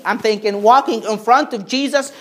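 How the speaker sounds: normal talking voice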